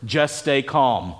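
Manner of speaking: a slow, hushed reassurance; calm, soothing. Shown as a demonstration. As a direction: ease them down, slow and low. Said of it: "these amazing three words".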